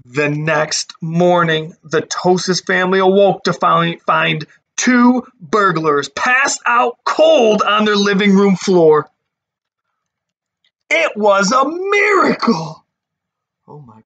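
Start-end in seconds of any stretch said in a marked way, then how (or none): 0.1-9.1 put-on lively read-aloud voice for kids; storybook narration. A narrator's reading. Teacher reading a picture book to her class, lively storytelling. 10.9-12.8 high, happy announcement; joyful exclamation. A narrator's reading. Narrator revealing the happy ending, delighted exclamation.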